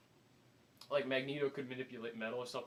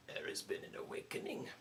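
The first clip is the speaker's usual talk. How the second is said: hushed, ominous trailer narration; soft, spaced-out impression, dropping very low at the end; Andy Serkis narrating; 9 dB quieter than usual. Narrator teasing the new Star Wars film, hushed ominous voice. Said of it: "it's Andy Serkis narrating".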